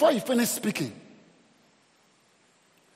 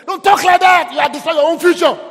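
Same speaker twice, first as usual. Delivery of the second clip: much louder and higher, a real shouted warning; stern rebuke; 10 semitones higher, 14 dB louder than usual. Real, not acted. Pastor shouting at the congregation to stop fearful talk, loud rebuke.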